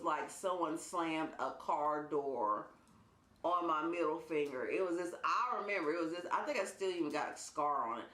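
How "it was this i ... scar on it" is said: ordinary delivery, nothing marked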